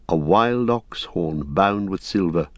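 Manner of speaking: ordinary delivery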